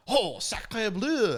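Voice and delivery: high, drawn-out hammed-up French exclamation; playful mock-French outburst. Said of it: "[name] speaks French".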